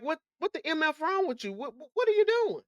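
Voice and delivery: high, exasperated retold cussing out; imitating herself cussing him out, voice up high. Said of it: "you know, cussing".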